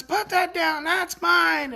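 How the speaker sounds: high-pitched mock yell; imitated angry demand. Boy seeing his toy picked up, mock angry yell. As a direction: imitate an upset kid claiming a toy. Upset boy snaps at carer.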